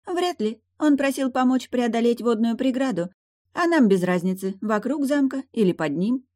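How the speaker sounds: high, airy-minded mermaid voice; indifferent mermaid. Acted. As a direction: answer offhand in a high pitch.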